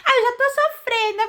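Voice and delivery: high wailing fake sob; mock crying. Hurt churchgoer who would quit, mock wailing. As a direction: whimper it like a wounded victim, mockingly. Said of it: "ia falar".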